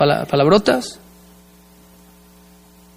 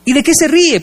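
suspicious wife; 8 semitones higher than usual. Retold or imitated. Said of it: "le dije a mi esposa".

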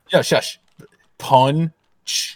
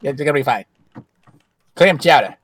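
a hammed-up Boston accent, a bit louder; loud Boston guy greeting his buddy; 5 dB louder than usual. Greeting Sully as a Boston local, a lightly put-on Boston accent. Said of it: "I'm from Boston".

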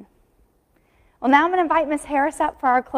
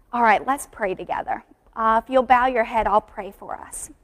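ordinary delivery

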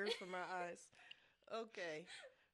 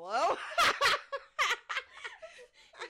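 high laughing shriek; 6 dB louder than usual. Real, not acted. Friend's hilarious text.

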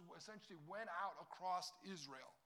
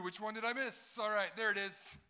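mock loud self-talk; 4 semitones higher, 10 dB louder than usual. Acting out scrolling old texts.

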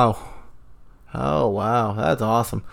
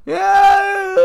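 put-on old lady voice; 3 dB louder than usual. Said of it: "Is that how old lady sounds?".

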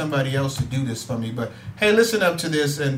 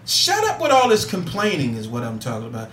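ordinary delivery

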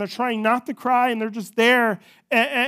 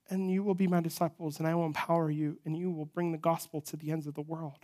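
hushed scripture quote; 3 semitones lower, 10 dB quieter than usual. Retold or imitated. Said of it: "Man, you talk about".

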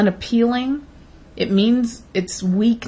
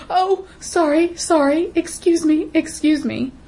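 much higher, slow, simpering "sorry, excuse me"; mock flustered apologizer; 6 semitones higher than usual. Mocking a shopper who freezes in the aisle, high simpering apologies. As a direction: play a startled shopper fluttering apologies. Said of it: "one person stops dead in their tracks".

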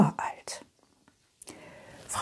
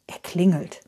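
plain reading voice

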